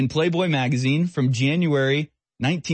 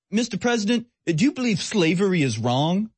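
reporter's question pitched much higher, condescending; mocking reporter; 7 semitones higher than usual. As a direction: parody a smug reporter grilling the president. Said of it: "These are the questions that are asked Donald Trump".

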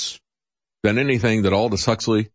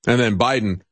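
normal talking voice

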